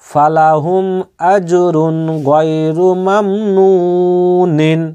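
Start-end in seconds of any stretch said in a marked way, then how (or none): 1.3-4.8 slow tajweed recitation of আজরুন গায়রু মামনুনিন, drawing out each syllable; slow Quranic recitation. Shown as a demonstration. Teacher demonstrating the verse to students, slow recitation. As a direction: chant আজরুন গায়রু মামনুনিন slowly with the nun held. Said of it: "এখানে দম ছাড়লে কি হতো?".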